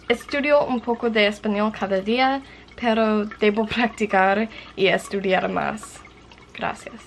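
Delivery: careful, deliberate learner's read-aloud in Spanish; reading a journal entry in Spanish. Acted.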